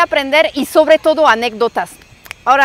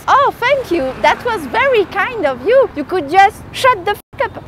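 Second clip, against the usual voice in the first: high, sugary mock gratitude turning rude; fake-polite thanks, pitched up; sarcastic comeback; 6 semitones higher than usual. Storyteller answering the girl who insulted her accent, sarcastic.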